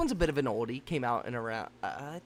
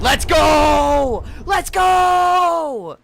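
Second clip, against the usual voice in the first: excited shout; 12 semitones higher, 11 dB louder, much slower than usual. Real, not acted. Beats hardest challenge first try.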